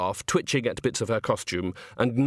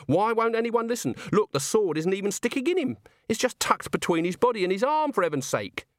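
put-on exasperated young woman; 10 semitones higher than usual. Agnes protests, voiced character.

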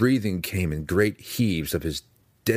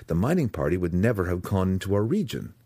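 ordinary delivery, nothing marked

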